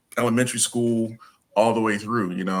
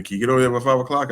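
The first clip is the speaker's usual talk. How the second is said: quick, pressing, a bit higher and louder; rushed urgent question; 4 semitones higher, 4 dB louder, much faster than usual. Retold or imitated. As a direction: hurry him along, time is short. Urgent question before office closes.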